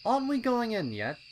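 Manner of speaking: high, loud, impatient colt voice; a keen little colt itching to go; eager young colt. A narrator's reading. Pipsqueak urging his friends at the trail, high and loud. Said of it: "asked Pipsqueak".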